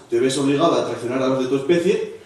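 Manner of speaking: normal talking voice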